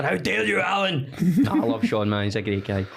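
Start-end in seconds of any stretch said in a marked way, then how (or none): 0.0-1.1 high-pitched indignant outburst at the telly; mock outrage. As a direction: imitate an outraged man yelling at the TV. Acts out Sean's outburst at TV.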